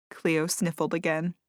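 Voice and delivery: ordinary delivery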